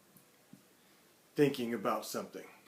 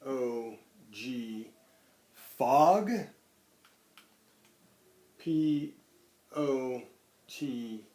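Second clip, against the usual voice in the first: put-on spelling aloud to children; much slower than usual. Shown as a demonstration. Spelling out the week's words.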